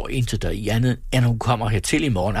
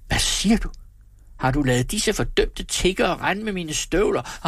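high-pitched indignant questioning; outraged husband; 7 semitones higher than usual. A narrator's reading. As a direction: react with outrage, pitch jumping up. Husband outraged at losing his boots.

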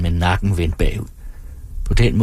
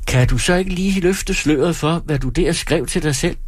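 lightly voiced showman, pitched up; teasing stage performer; 9 semitones higher than usual. Performer asks the woman to reveal her note, teasing. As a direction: coax her with mock curiosity. Performer mocks audience member.

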